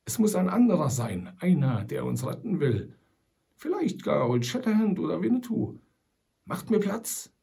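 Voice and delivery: lightly voiced wry old trapper; old trapper Sam. A narrator's reading. Sam Hawkins argues with fellow prisoners, wry old trapper. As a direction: play the shrewd old scout, reasoning it out.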